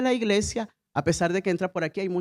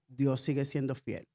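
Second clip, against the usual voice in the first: softer, lower affirmation; soft declaration to repeat; 9 semitones lower, 8 dB quieter than usual. Shown as a demonstration. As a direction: say it quietly as a confession.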